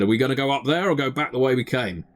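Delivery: high, hurried character voice; Dredd. Acted. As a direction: rattle out the anxious question. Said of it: "says Dredd".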